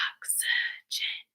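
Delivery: very soft, voiceless oxygen, syllable by syllable; whispered oxygen. Shown as a demonstration. Teacher asking pupils to whisper with her, whispered oxygen. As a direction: whisper oxygen in three separate beats. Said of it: "Whisper with me".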